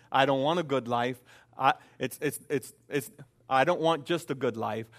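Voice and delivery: usual speaking voice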